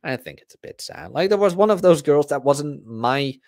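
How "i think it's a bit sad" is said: much softer quiet repeat; quiet disappointed echo. Shown as a demonstration. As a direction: let "I think it's a bit sad" drop to a hush.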